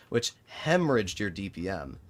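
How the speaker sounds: usual speaking voice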